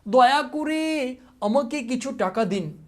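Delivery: slow, high begging plea; pleading request. Shown as a demonstration. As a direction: beg for money like a pauper. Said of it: "এটা কী ধরনের বাক্য?". (অনুনয় করে ধীরে ভিক্ষা চাওয়া)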